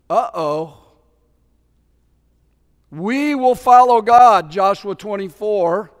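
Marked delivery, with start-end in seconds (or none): 2.9-4.5 loud, slow, proud declaration; bold vow. Retold or imitated. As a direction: swear it out with bravado. Quoted people's vow.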